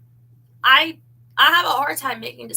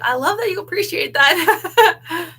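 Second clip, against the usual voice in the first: laughing quote; 7 semitones higher, 10 dB louder, slower than usual. Retold or imitated. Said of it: "He's like".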